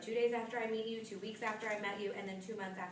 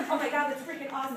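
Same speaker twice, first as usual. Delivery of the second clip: louder, higher, thrilled squeal; excited gush; 5 semitones higher, 9 dB louder than usual. Retold or imitated. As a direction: gush like a fan meeting her idol. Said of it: "She was so excited".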